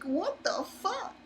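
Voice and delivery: high, slow, drawn-out what the fuck; stunned disbelief. Retold or imitated. Reacting to the villain's missile packs in disbelief. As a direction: retell the shock, stretching it out. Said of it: "I was like".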